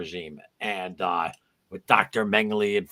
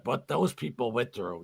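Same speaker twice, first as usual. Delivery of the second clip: usual speaking voice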